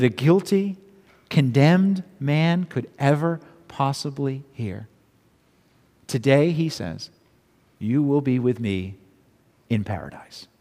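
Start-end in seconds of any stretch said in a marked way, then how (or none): 6.1-10.5 soft and hushed, like a gentle promise to a dying man; tender quiet promise. Acted. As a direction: let the words of comfort fall low and quiet. Jesus's promise, quoted softly.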